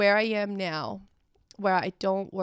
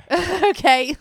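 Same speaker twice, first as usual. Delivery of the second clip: bursts of loud, high laughter; laughing; 9 semitones higher, 11 dB louder than usual. Real, not acted. Mother recalling the harp music, laughing out loud. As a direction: laugh out in disbelief.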